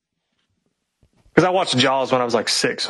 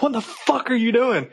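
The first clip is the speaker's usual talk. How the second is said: retold yell; 8 semitones higher than usual. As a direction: imitate yelling in outrage at the pilot, pitch jumping up. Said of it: "I yelled at the pilot".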